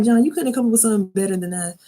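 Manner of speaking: high, fast teasing reproach; mock incredulous complaint. Viewer scolding John for a corny line, mock incredulous. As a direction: rattle it off high, in playful disbelief.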